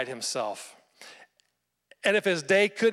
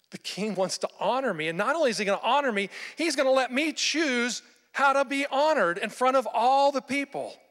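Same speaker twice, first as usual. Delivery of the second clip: growing excitement; 8 semitones higher than usual. Retold or imitated. Haman's thoughts building with excitement.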